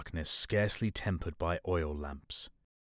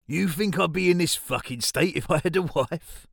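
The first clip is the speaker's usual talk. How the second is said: put-on loud, high, rough drunk rant; bitter drunk; 10 semitones higher, 7 dB louder than usual. Drunk Johnny's bitter outburst.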